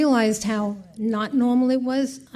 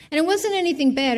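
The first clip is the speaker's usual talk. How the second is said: usual speaking voice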